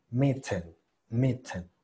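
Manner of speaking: "Mitten" with a true T in the middle; T pronounced clearly. Shown as a demonstration. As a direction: say "Mitten" sounding the T, never a tapped R. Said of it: "me gusta a mí decir más". ("Mitten" con T marcada, no como R)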